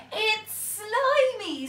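very high, loud, slow disgusted squeal; disgusted pirate. A narrator's reading. Pirate girl complaining about fish pie, high squeal. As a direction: stretch it out in disgust. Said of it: "said Peggy Polkadot".

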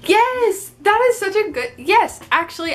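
high, excited burst of yes; real gushing enthusiasm, easing down at the end; delighted agreement. Real, not acted. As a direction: jump on the suggestion with a high-pitched squeal. Delighted reply to sister's joking suggestion.

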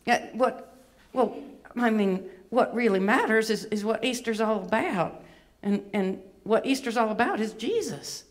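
earnest Southern belle puppet; put-on Atlanta accent, sincere; Miss Bevy. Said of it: "she does have a bit of an accent".

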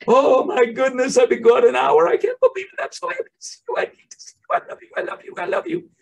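put-on very high, squealing baby talk to a dog; over-the-top gushing. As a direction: gush at the dog like you can't contain yourself. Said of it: "With our dogs, we say".

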